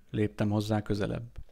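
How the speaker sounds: usual speaking voice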